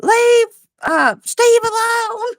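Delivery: high, loud mock yell backstage; mock screaming. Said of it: "why y'all are screaming".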